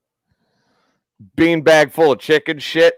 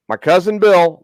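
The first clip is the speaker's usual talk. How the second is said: normal talking voice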